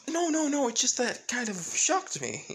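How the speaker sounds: high, quick-reassurance mother voice; acted high-pitched reassurance; Toga. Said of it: "pretty much Toga saying".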